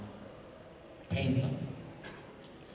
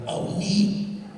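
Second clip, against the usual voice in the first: high mock delighted oh neat; retold delight; 9 semitones higher than usual. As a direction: squeak it out with delight. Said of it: "I went".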